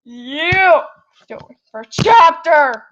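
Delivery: high excited yelling; excited squeal. Real, not acted. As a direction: squeal it out, thrilled. Excited shout at stream.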